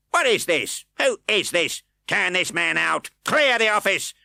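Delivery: high, loud outraged bark; angry cry. A narrator's reading. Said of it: "cried Mister Fang".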